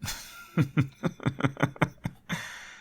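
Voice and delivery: high airy villain cackle; villainous laugh. Acted. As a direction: laugh at them, amused and superior. Mocking the helpless hero.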